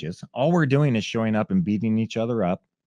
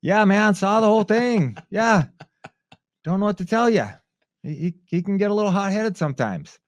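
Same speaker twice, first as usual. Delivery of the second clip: wry apology; 11 semitones higher, 4 dB louder than usual. Retold or imitated. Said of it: "he just goes".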